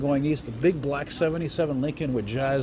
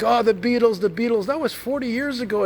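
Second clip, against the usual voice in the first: high, loud mock protest; acted-out scornful retort; 11 semitones higher, 4 dB louder than usual. Retelling a TV scene to a friend, high mock exasperation. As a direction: imitate an exasperated movie character, pitch pushed up. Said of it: "He's like".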